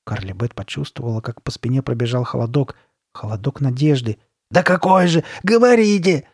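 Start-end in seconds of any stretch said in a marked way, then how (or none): 4.5-6.3 high, loud, desperate begging; pleading. A narrator's reading. Girl begging the shopkeeper to speak, urgent plea. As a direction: beg urgently at full voice. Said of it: "взмолилась она".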